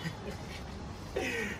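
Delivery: short unvoiced laugh; breathy laugh. Real, not acted. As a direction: let out a quick airy laugh. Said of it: "sorry for laughing".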